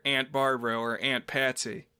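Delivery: "Aunt" said like "ant" before each name; short a of "cat" in both "Aunt"s; everyday short-a pronunciation. Shown as a demonstration. Host names relatives as addressed, "Aunt" said as "ant". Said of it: "if I'm talking to one of my aunts".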